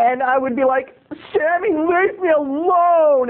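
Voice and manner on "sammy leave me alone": put-on high, drawn-out kid's complaint; mock whiny protest. Pestered boy tells off the camp bully, drawn-out whine. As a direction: imitate a pestered boy, stretching it slowly. Kid pleading with bully, retold.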